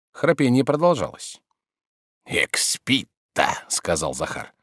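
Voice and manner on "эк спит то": slow, low, whispery muttering; muttered aside. A narrator's reading. Servant marvelling at his sleeping master, slow whispery mutter. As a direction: draw it out under your breath.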